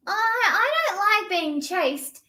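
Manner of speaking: high, small bird voice; a tiny bird protesting nervously; worried baby bird; little bird. Acted.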